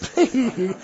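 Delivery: short laugh. Real, not acted. Laughs at own joke.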